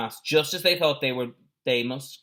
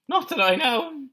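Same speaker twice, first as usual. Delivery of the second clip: put-on high boyish voice; 5 dB louder than usual. A narrator's reading. Jack's reply, voiced.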